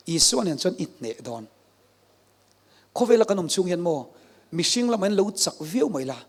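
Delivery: ordinary talk, nothing marked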